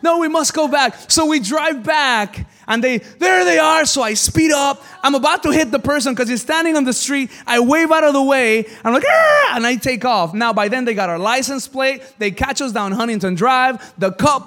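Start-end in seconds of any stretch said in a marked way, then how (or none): 0.0-0.9 put-on high, fast, louder worried girl; panicked girl. Scared girl insists on going back, high and anxious. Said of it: "one of the girls got scared". 9.1-9.6 high loud yelp; startled yell. Retold or imitated. Driver nearly hits man, high startled yelp. Swerving near person, yelling.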